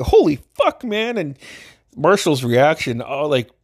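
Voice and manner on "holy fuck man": mock shocked yelp. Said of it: "and I was like".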